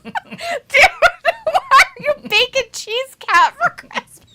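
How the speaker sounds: cracking up while asking "why are you baking cheese cat"; laughing hard. Real, not acted. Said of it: "God, I'm laughing so hard right now".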